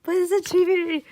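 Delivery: high, loud burst of delight; excited squeal. Real, not acted. Fan reacting to a gifted chibi drawing, excited squeal. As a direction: squeal it out, thrilled.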